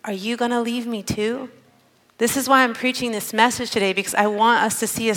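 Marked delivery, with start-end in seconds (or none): 0.0-1.5 soft, vulnerable plea; Jesus. Retold or imitated. Jesus asks the twelve instead of boasting, softly vulnerable. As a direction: drop to a gentle, open-hearted question. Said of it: "vulnerable side that he shows those who he loves".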